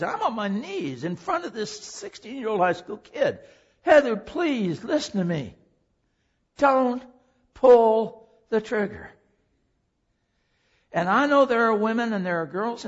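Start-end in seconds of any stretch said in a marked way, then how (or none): 3.8-5.5 loud, slow, drawn-out begging; desperate plea. Retold or imitated. Preacher on his knees begging Heather not to shoot. 6.6-9.1 loud and slow, each word hammered; urgent plea. Retold or imitated. Preacher begging Heather not to pull the trigger.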